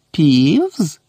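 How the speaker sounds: drawn-out, insinuating query; stealthy voice. A narrator's reading. Professor querying the caretaker's culprit, in a stealthy voice. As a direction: question the name slyly, stretching it. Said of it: "украдливим голосом перепитав Снейп".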